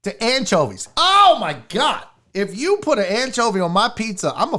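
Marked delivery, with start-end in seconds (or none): 1.0-2.0 slow, loud, high disgusted outburst; appalled and emphatic; outraged exclamation. Real, not acted. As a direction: draw it out in disbelief. Outraged at anchovies on the menu.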